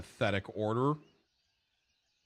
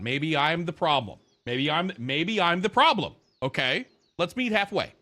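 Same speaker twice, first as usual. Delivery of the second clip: sarcastic; 5 semitones higher, 5 dB louder than usual. Acted. Sarcastic mock self-blame.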